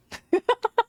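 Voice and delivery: high-pitched real giggle run; laughing. Real, not acted. Streamer joking about redoing it for Yuna, high laugh.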